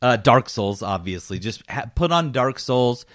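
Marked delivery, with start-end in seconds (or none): none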